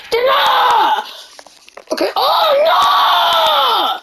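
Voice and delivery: high, drawn-out cry of dismay; dismayed wail. Real, not acted. Player watching the game go wrong, dismayed wail. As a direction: wail it high and long, then let it fall.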